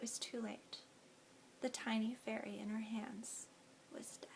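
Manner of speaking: fading whisper, dying away to nothing; whispered closing line of a sad scene; hushed whisper. A narrator's reading. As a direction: drop to a whisper and let it die. Fairy dies, narration fades out.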